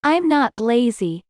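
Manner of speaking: usual speaking voice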